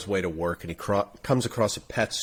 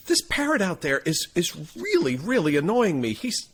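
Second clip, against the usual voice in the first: higher-pitched complaint, easing down at the end; exasperated customer; 7 semitones higher than usual. Acted. Guy complaining to the store owner, exasperated. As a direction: plead your grievance as a fed-up customer. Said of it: "he says".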